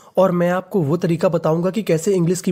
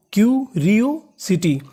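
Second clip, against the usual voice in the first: Curiosity stretched out slowly, syllable by syllable; much slower than usual. Shown as a demonstration. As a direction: model curiosity slowly for the class. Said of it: "तो पूरी meaning". (Curiosity को बहुत धीरे, टुकड़ों में बोलें)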